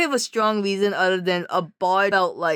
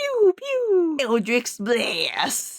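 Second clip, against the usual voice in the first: spell casting; 5 semitones higher, much slower than usual. Pretend. Spell-casting sound and name.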